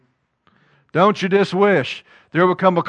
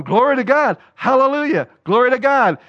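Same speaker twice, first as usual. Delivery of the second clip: higher, lightly sing-song churchy praise; pious praise; 7 semitones higher than usual. Retold or imitated. A believer gushing praise before turning, sing-song. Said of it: "they're going".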